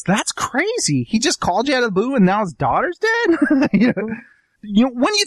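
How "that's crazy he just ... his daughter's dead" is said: mock wife line. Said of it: "a scene where she goes".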